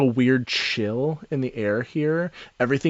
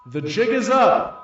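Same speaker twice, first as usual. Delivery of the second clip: higher goblin voice through a megaphone; 10 semitones higher than usual. Acted. Goblin with megaphone ordering the party to surrender, pitched up. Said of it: "holds up a megaphone and goes".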